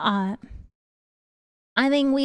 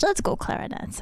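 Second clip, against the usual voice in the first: whispered cheer; 5 semitones higher, 5 dB quieter than usual. Real, not acted. Cheering viewers.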